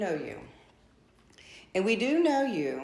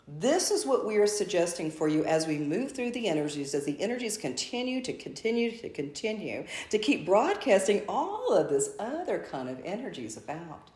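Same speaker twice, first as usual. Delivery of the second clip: normal talking voice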